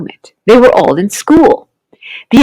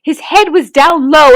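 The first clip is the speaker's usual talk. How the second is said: plain reading voice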